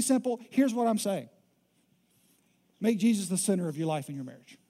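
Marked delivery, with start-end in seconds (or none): none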